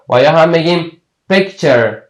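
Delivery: ordinary delivery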